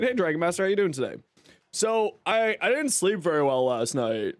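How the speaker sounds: ordinary talk, nothing marked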